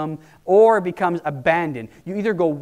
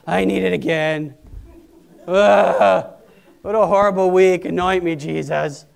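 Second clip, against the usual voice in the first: louder groaning, worn-out complaint; weary churchgoer; 5 dB louder, slower than usual. Retold or imitated. Preacher mocks worn-out churchgoers, louder groaning plea. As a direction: moan it like a battered week.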